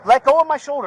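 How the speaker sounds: high, loud demand; angry shout. Real, not acted. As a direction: yell at him to let go. Shouting at officer.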